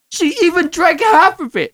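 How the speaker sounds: 2 high, squeaky indignation; 3 can't believe the nerve of her; 1 outraged disbelief. Retold or imitated. 4 man reading ex's mocking note, high indignant squeak. Retold outrage at half-drunk bottle.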